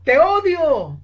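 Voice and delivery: loud, hurt shout of hate; retold shout. Young Francis shouts into the echo, loud angry cry. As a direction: fling it out to the empty yard. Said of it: "Gritó".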